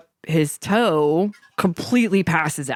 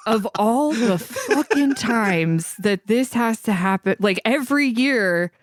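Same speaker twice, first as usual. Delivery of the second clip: higher, sarcastic disbelief; a retold sarcastic grumble; exasperated inner rant; 5 semitones higher than usual. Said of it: "I'm like".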